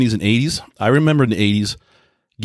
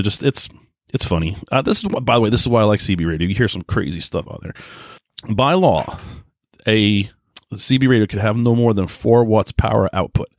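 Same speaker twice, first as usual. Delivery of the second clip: ordinary delivery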